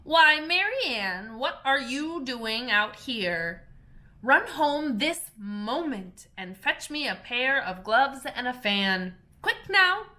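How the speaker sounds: angry call. Acted. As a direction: scold the servant and order her off. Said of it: "called out to her in an angry tone".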